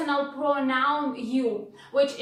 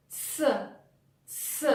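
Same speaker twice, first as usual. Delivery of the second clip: a soft, voiceless ts sound; hissed ts sound; 7 dB quieter than usual. Shown as a demonstration. Teacher demonstrating ts to learners, voiceless and soft. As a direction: hiss out ts. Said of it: "combination of two sounds T and S".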